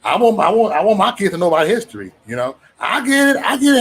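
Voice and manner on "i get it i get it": high emphatic. Real, not acted. Emphatic conceding.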